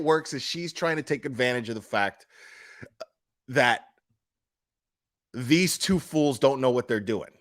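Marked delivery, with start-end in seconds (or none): none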